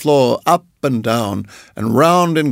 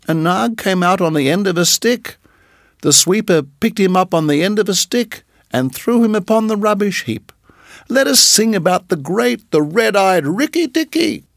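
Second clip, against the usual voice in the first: higher, excited chirpy bird; little bird voice; 7 semitones higher than usual. Acted. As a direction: chatter proudly like a small bird. Darzee voice.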